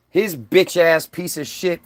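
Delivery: ordinary talk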